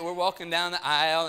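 ordinary talk, nothing marked

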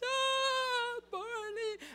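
very high-pitched delighted squeal; squealing kids imitation. Sunday school kids greeting Barney, high squealing. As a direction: squeal like thrilled little children. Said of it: "Barney comes in and the kids".

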